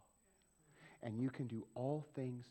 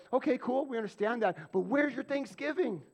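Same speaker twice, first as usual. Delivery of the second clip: exasperated reply; 12 semitones higher, 10 dB louder than usual. Pretend. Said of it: "it's like".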